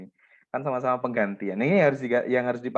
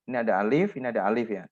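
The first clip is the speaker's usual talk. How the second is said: normal talking voice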